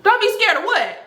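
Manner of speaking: high, puzzled retort; baffled question. Retold or imitated. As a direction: caught off guard, asking what she should fear. Her own baffled reply.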